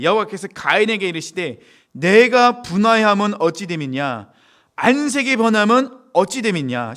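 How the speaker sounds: loud declamatory verse reading; preacher delivering the verse at full voice; scripture reading. Acted. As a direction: proclaim God's question with slow, weighty stresses. Reads God's words from scripture.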